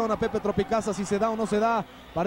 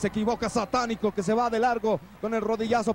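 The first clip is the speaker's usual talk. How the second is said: ordinary talk, nothing marked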